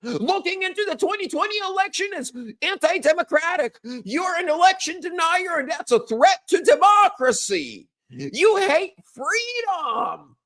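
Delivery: mocking, high-pitched parody of outraged critics; mocking Democrats. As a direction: ham up a hysterical opponent. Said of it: "How did the Democrats react?".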